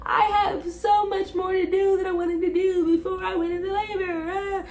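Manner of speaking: high, wailing mock sob; imitating crying. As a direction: whine it like a panicked crying mom-to-be. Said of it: "It's chaos 'cause I'm crying".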